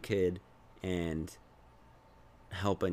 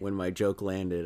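ordinary talk

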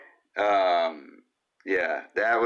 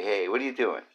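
ordinary talk

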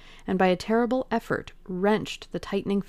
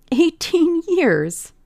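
much higher, slow, laughing with triumph; triumphant laugh; 11 semitones higher, 8 dB louder, much slower than usual. Acted. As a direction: savour the words, laughing in relief. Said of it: "laughed Dorian Gray with a touch of triumph".

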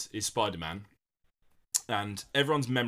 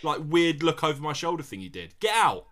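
loud, higher, indignant put-down; mock outraged; 6 semitones higher, 4 dB louder than usual. Barista mocking the hero, loud and indignant. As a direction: blast him as the baffled barista. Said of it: "she wouldn't go like".

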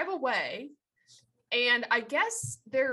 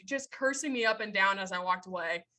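ordinary talk

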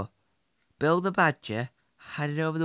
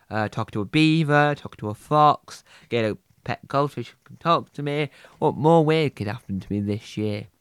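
low character voice; 7 semitones lower than usual. Acted.

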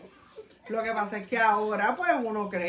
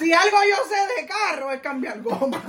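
laughing boast; 6 semitones higher than usual. Real, not acted. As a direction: blurt it out through laughter. Laughing boast about mother.

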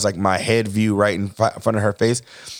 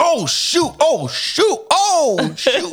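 high-pitched comic alarm; startled; much slower than usual. Retold or imitated. Husband retells being scared by wife's scream, startled high yelps. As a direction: yelp in panic, re-enacting the jump. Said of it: "I jump 'cause it scares me".

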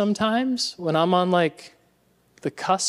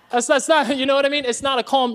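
ordinary talk

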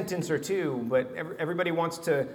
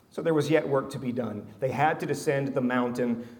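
ordinary talk, nothing marked

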